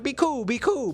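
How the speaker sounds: high, insistent coaxing; urging calm. Retold or imitated. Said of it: "I was like".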